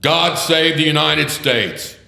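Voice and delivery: loud, high bailiff proclaiming court in session; court crier. Retold or imitated. Bailiff opening federal court.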